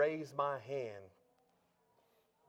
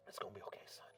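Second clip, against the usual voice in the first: soft whisper; 5 semitones lower, 17 dB quieter, faster than usual. Retold or imitated. Mom comforting son.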